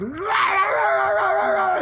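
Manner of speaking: high, slow, strained mock scream; hammed-up yell at the top of the lungs; retold furious shriek, dragged out; imitating a screaming yell. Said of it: "yelling at the top of your lungs".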